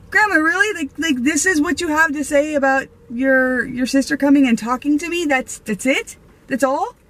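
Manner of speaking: can't believe what she just heard; stunned granddaughter protesting to grandma, incredulous. Retold or imitated. Stunned at grandmother's casual reply.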